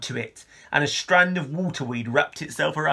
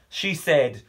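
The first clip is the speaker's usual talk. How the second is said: usual speaking voice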